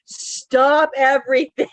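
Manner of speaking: high, loud, drawn-out shock; mock-panicked, slowing for emphasis; retold alarm. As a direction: imitate a doctor halting everything in alarm.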